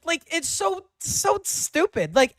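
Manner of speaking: screaming high and loud in outrage; real enraged yelling at a dodge; furious shout. Real, not acted. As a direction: blow up at him at the top of your voice. Outraged at caller dodging question.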